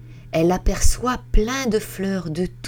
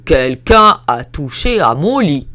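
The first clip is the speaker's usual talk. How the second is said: loud, heavy papa bear; big bear; 3 semitones lower, 8 dB louder than usual. Acted. Big bear finds his bed touched, booming loud. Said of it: "son grand lit et dit".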